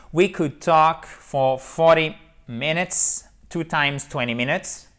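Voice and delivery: slow careful English model line; model English reading. Shown as a demonstration. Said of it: "ça c'est votre trame". (lecture modèle lente en anglais)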